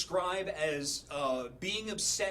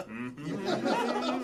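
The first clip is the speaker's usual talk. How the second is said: stifled mm-hmm; 4 semitones lower, 3 dB louder than usual. Retold or imitated. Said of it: "And I was like".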